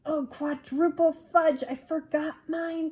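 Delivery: put-on high, loud kid's exclamation; dismayed child. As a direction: cry out in cartoonish frustration. Drew dismayed at forgetting.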